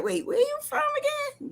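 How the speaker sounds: high mock surprise at her accent; puzzled question. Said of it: "I'm like".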